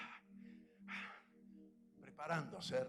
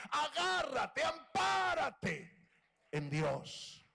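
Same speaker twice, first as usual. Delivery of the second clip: stretched shouted exhortation, very high; preaching shout; 9 semitones higher, 9 dB louder, much slower than usual. Real, not acted. Preacher shouting to the church, slow fervent cry. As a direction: hold on to God, shout it out slow.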